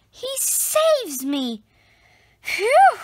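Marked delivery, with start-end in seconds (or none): 2.4-3.0 relieved phew. A narrator's reading. Saved by the dog.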